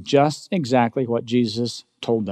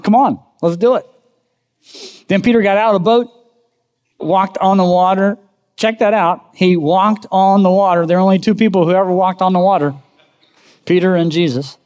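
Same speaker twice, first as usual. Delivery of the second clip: excited; 6 semitones higher, 4 dB louder than usual. Acted. Excited retelling of water walk.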